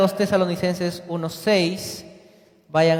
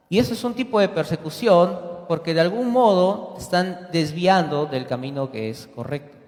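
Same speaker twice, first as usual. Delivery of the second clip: usual speaking voice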